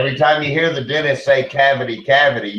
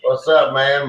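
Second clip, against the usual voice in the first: ordinary talk, nothing marked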